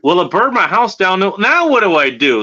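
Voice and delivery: whiny naive question; mock clueless player. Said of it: "is like".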